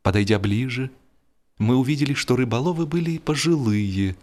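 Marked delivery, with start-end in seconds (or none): none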